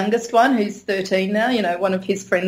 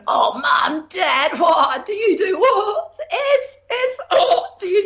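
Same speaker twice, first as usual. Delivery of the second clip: put-on excited little boy; 8 semitones higher, slower than usual. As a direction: play a five-year-old scandalised by a naughty secret. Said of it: "he came home from school and he was going".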